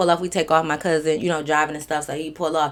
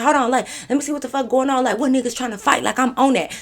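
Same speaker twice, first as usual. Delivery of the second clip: high, insistent friend voice; retold high-pitched defiance; imitating feisty friend; 6 semitones higher, faster than usual. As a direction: play the friend itching to step in, pitched up. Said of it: "She like".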